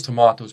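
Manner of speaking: "tomatoes" as tuh-MAH-toes; British pronunciation. Shown as a demonstration. Teacher quoting the song, British "tomatoes" with MAH. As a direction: say "tomatoes" with a long AH. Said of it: "we say tomatoes".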